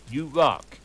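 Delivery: usual speaking voice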